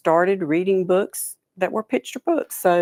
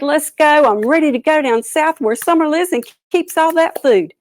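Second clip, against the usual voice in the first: high, loud eagerness; raring to go; eager turtle; 7 semitones higher, 5 dB louder than usual. A narrator's reading. Turtle telling birds he is ready, high and loud.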